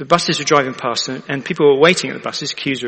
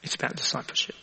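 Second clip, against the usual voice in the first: hushed reveal; 13 dB quieter, faster than usual. Real, not acted. Preacher's reveal to congregation.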